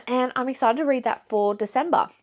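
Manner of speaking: usual speaking voice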